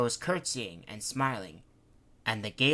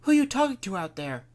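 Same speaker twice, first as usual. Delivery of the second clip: high, loud, fast concern; worried; 10 semitones higher, 8 dB louder, faster than usual. A narrator's reading. Wife Nora anxiously asking her husband, high and fast. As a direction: ask in alarm.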